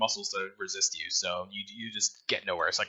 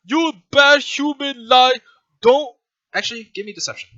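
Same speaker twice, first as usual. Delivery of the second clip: menacing simple creature; 11 semitones higher, 10 dB louder than usual. Acted. Guard creature answers prisoner.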